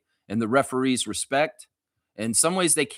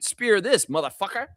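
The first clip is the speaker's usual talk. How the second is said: taunt; 7 semitones higher than usual. Acted. Trash talk at Dolan.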